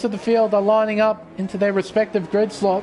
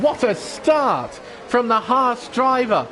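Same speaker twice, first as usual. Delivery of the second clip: usual speaking voice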